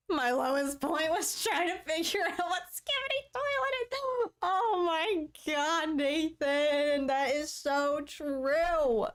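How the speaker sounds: high, squeaky tearful wail; high-pitched, overwhelmed lament; wailing dismay. Real, not acted. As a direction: wail it high, dragging out the last words. Agreeing, overcome with tearful dismay.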